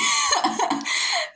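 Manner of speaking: high, breathy burst of laughter. Real, not acted. Streamer amused by the nickname, high laugh. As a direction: crack up in high-pitched giggles.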